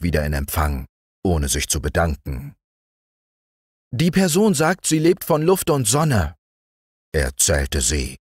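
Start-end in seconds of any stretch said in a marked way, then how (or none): 3.9-6.3 high ant voice; gossiping ant. A narrator's reading. Ant reporting.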